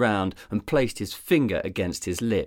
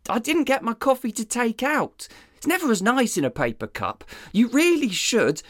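much higher, dopey sidekick voice; dim deputy voice; 13 semitones higher than usual. Acted. Deputy rambling to his boss about coffee, dopey and high. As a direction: play the clueless deputy.

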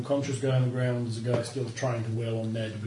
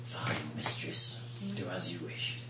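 dragon voice; 6 semitones lower, 8 dB quieter, slower than usual. Acted.

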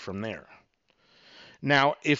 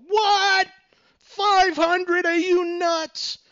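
much higher, louder, slower mock outrage; shocked flinch; 6 dB louder, much slower than usual. Shown as a demonstration. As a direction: recoil at the price in disbelief. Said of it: "what you want to do is flinch".

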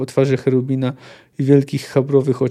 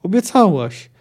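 higher-pitched dying reproach; moderately raised cry to a comrade; indistinct cry; 5 semitones higher, 4 dB louder than usual. A narrator's reading. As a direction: call in a promise, acted. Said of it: "krzyknął niewyraźnie".

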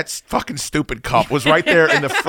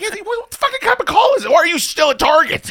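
high-pitched, incredulous mock yell; exasperated outburst; 4 dB louder, faster than usual. Host retelling his reply to friend on phone, exasperated. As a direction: squawk it in comic exasperation, voice jumping right up.

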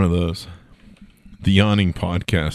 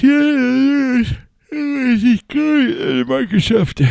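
much higher words stretched through a yawn; yawning while talking; 3 dB louder, slower than usual. Pretend. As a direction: talk right through a big yawn. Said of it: "I'm gonna do a yawning podcast".

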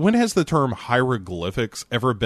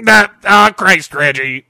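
mock loud groan; 6 semitones higher, 11 dB louder than usual. Mock outburst.

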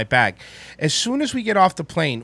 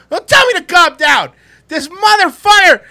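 retold angry yell; 12 semitones higher, 9 dB louder than usual. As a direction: shout back at your wife who tells you to calm down. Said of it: "I was so mad".

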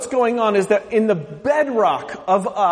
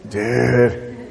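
drawn-out lazy surfer "Dude"; 7 semitones lower, much slower than usual. Retold or imitated. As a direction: do the laid-back surfer guy. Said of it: "talk like this guy".

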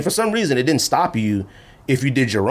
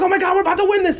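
very high, thrilled fan squeal; mock excited fan; much faster than usual. Imitating a sports fan before the loss, squealing high. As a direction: sound sure the win is coming. Fan sure of playoff win.

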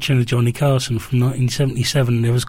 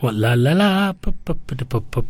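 jazzy "pu" lip pops; soft lip popping. Shown as a demonstration. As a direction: pop the lips lightly, like scat. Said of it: "You got a jazz mouth".

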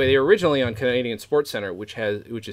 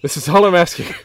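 loud, raised-pitch begging; fed-up boss imploring at high volume; exasperated acted pleading; 5 semitones higher, 12 dB louder than usual. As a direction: plead loudly with a difficult hired actor. Acted exasperated pleading with hired actor.

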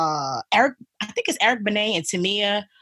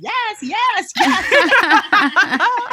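high, louder, laughing repeated yes; excited yes; 12 semitones higher, 6 dB louder, much slower than usual. Retold or imitated. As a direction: cry out yes with delight. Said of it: "I just started saying".

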